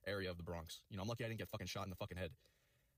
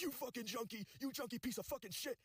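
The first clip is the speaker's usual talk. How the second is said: retold angry screaming. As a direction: mock-scream the insults far above your usual pitch. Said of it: "he was screaming at me too".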